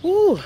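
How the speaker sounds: loud high whew blown out from the heat; hot exhale. Real, not acted. Resting after long walk.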